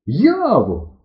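high, loud call; calling aloud. A narrator's reading. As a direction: call out openly for a friend. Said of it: "кричу вголос". (гукає вголос)

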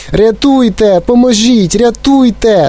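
very high cries for help; frantic wailing. A narrator's reading. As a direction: cry out like a drowning boy. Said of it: "залементував він". (несамовитий лемент про допомогу)

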